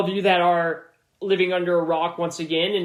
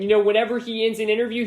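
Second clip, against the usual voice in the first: ordinary delivery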